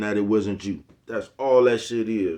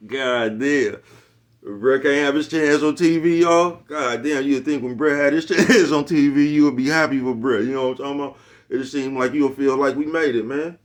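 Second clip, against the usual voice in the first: excited; 5 semitones higher, 7 dB louder than usual. Real, not acted. Worked up after laughing.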